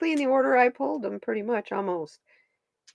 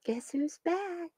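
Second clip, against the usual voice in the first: sing-song pet greeting; 8 semitones higher, 11 dB quieter, slower than usual. Real, not acted. Greeting the cat back in view, soft baby talk. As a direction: coo at the cat coming back.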